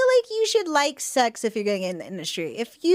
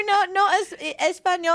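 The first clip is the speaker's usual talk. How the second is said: bad Spanish accent; 7 semitones higher than usual. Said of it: "you have a bad accent".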